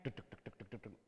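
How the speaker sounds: whispered heartbeat noise, deg deg; heartbeat sound. Retold or imitated. Pastor at the ATM mimics his pounding heart.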